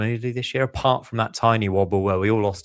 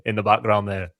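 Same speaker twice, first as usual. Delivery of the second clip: ordinary delivery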